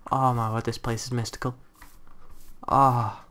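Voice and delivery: ordinary talk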